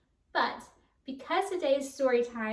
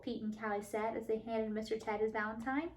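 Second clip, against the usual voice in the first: ordinary delivery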